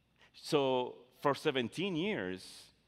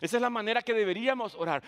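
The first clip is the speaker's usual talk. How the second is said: usual speaking voice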